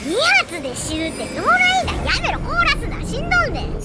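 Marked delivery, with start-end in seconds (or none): 1.4-3.8 high loud protest; real frustration shouted at the enemy; loud fed-up outburst; exasperated yelling. Real, not acted. Being frozen by enemy.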